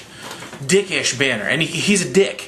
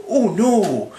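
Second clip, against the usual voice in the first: higher-pitched cartoon worry; hammed-up uh-oh moment; mock dread; 7 semitones higher than usual. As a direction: act alarmed, half-seriously. Voicing Loki seeing lightning.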